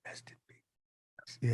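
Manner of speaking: a soft, reverent whisper for a lost friend; hushed respect. Real, not acted. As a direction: breathe it out gently in mourning. Mourning late friend Jason David Frank.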